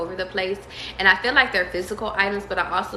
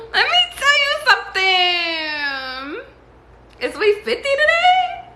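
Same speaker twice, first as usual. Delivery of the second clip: much higher and louder, squealing with delight; excited squeal; 5 dB louder, slower than usual. Real, not acted. Reader squealing to client Morgan, real excited squeal. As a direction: squeal the name like you just got great news.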